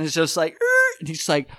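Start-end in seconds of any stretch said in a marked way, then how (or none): 0.5-1.0 high-pitched creaking noise; vocal sound effect of a roof lurching; imitated creak. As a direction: squeak like a beam taking the strain. Imitates roof caught on scaffolding.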